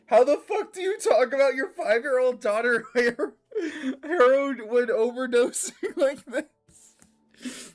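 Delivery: breaking into tearful laughter; laughing in disbelief. Real, not acted. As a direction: laugh helplessly through the question. Reacting to shocking game dialogue.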